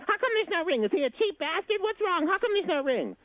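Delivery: shrieky falsetto mock outrage; hammed-up high female disbelief; shocked girlfriends. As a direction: mimic indignant girlfriends firing questions, very high. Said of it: "And they would say".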